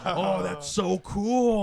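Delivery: loud, high, slow mock gushing; imitated awe. Translating Japanese players' reaction for a friend, exaggerated awe. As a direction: play up being hugely impressed, drawing it out. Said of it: "they're just like".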